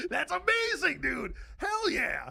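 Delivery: loud, high laughing cheer; hearing the meth story, shouting with laughter. Real, not acted. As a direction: whoop with delighted laughter.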